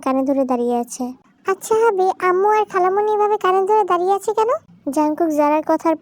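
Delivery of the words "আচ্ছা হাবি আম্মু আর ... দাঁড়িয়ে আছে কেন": lightly voiced inquisitive girl, pitched up; curious child wife. Zara asking her husband about mother and aunt, curious. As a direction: ask with innocent curiosity.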